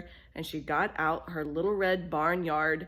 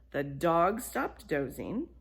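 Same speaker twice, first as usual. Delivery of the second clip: plain reading voice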